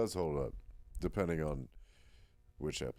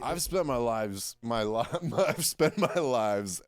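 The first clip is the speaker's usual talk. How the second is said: laughing; 4 semitones higher, 6 dB louder, slower than usual. Real, not acted. Flubbed line repeated, laughing.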